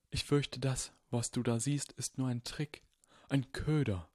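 put-on old wizard, hushed and grave; quiet, worried old headmaster; voiced old Dumbledore. Dumbledore warning Harry, hushed old wizard voice.

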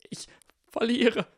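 high, strained whispered surrender; forced whisper. Acted. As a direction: squeeze the words out broken. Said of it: "zwang Harry hervor". (gepresst geflüstert)

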